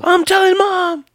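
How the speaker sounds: slow, very high tattletale whine; whiny tattling little sibling. Pretend. Tattling like a kid.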